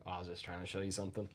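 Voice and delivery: soft, fast sheepish mumble; quiet fast mumble. Retold or imitated. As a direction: rush it under your breath. Retold flirty line to nurse.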